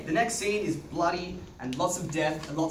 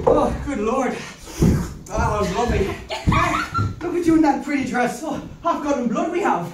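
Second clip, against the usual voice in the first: a lively, louder Irish brogue; Irish accent; 9 semitones higher, 7 dB louder than usual. Acted. Man in Irish accent fussing over a bloodied girl. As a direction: play it broad and rural Irish. Reads Irish play part.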